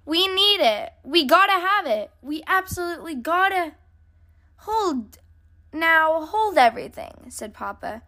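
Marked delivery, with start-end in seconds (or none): 0.0-3.7 high, urgent pleading cubs; breathless kid who desperately wants it; gasping excitement. A narrator's reading. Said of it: "they gasped". 4.6-7.3 higher-pitched call to stop; exasperated dad calling a halt; halting interruption. A narrator's reading. Papa cuts off the excited cubs.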